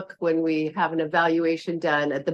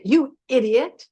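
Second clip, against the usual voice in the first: higher, slow self-reproach; self-scolding tone; 7 semitones higher than usual. Acted. Yoga teacher acting out how you scold yourself, self-critical. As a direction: draw out a mock insult at yourself. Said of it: "all those words also have a tone to them".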